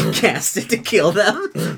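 ordinary talk, nothing marked

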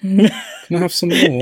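high, slow pleading orphan with a laugh; Oliver Twist. Acted. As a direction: beg like a hammed-up little orphan, half laughing. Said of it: "Oliver Twist".

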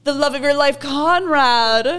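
high, loud, gushing mock presentation; mock swooning pitch. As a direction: hype the dreamboat, hammed up. Mock show pitch of favoured brother.

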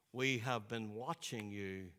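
deep, slow, hushed imitation of Sidney Poitier; solemn whispery elder's voice; Sidney Poitier impression. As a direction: drop the voice low and slow like a grand old actor. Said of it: "I've been practicing that all week".

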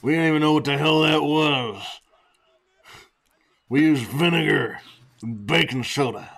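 put-on old man voice. Old-timer bit continued.

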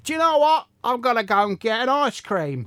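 lightly put-on Yorkshire lilt; Yorkshire accent. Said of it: "doing a Yorkshire accent".

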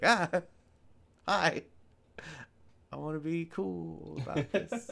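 high, awkward mock greeting; can't handle it. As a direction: act out failing to play it cool. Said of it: "I don't know how to handle that".

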